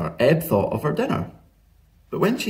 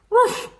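high-pitched little dog yap; sharp, squeaky ruff; dog bark. A narrator's reading. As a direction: bark like an excited small dog. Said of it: "said Ebb".

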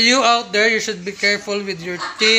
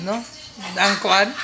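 ordinary talk, nothing marked

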